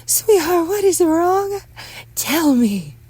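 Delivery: high, loud motherly pleading; anxious mother. Acted. Mother questions son about illness, high anxious voice.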